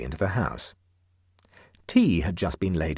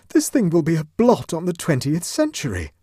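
higher, louder condemnation; outraged; 10 semitones higher, 6 dB louder than usual. A narrator's reading. Huddle to Clovis, loud high outrage.

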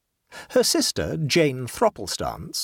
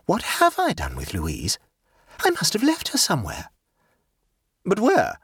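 a hint of a pleased, scatterbrained aunt; light, self-satisfied chatter; gaily; 8 semitones higher than usual. A narrator's reading. Jane's voice.

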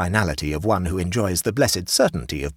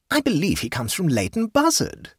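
a hint of a fussy higher hostess; lightly voiced hostess; 13 semitones higher than usual. A narrator's reading. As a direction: raise the pitch for the chatty hostess.

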